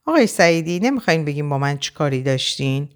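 ordinary delivery

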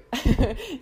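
a quick real laugh mid-sentence; little giggle; bright laugh. Real, not acted. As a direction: let a short laugh burst out. Laughing while reassuring listeners.

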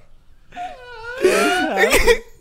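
long high screeching laugh; pterodactyl shriek. Real, not acted. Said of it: "You sound like a fucking pterodactyl".

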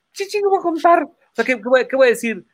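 high-pitched exasperated quote; mock flustered protest. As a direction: act out panicking over a pile of cash. Acts out flustered cash counting. (queja aguda y agobiada)

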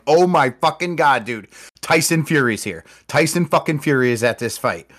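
can't believe who just showed up; excited disbelief. Retold or imitated. Telling his brother Tyson Fury is here, thrilled. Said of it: "and I'm like".

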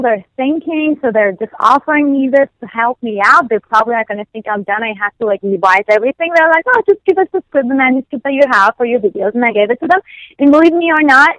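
ordinary talk

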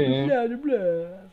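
slow, drawn-out vampire blah; Dracula. Acted. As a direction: stretch out the hammy vampire catchphrase. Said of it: "It's fucking Dracula".

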